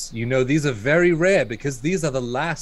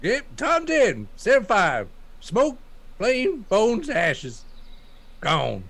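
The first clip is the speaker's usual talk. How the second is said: high, choppy yokel grunts; 7 semitones higher, slower than usual. Acted. Disguised yokel to merchant, broken yokel talk. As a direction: clip it like a simple country yokel. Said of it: "A yokel".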